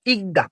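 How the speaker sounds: ইকদা as two joined syllables, ik-da; pronunciation demo. Shown as a demonstration. Teacher repeating the answer for learners, demonstrating ইকদা as ik-da. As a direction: repeat the model ইকদা, ik closed straight into da. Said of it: "কি উচ্চারণ হবে?".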